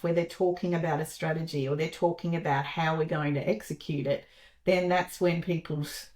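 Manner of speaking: ordinary talk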